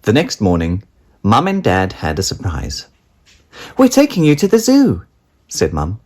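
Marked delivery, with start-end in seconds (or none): 3.8-5.0 high, fast bright mother voice; cheery mum. A narrator's reading. Mum telling Daisy the surprise, high and fast. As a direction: announce it like a chirpy mum.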